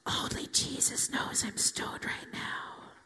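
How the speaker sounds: slow, furtive stage whisper; whispered inner thought. Retold or imitated. Stoned in a church pew, thinking to herself, slow whisper. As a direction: whisper it slowly, sharing a secret with yourself. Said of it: "and think to myself".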